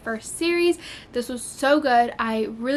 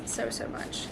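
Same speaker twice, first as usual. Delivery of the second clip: whispered; 10 dB quieter than usual. Real, not acted. Trailing whisper about loved book.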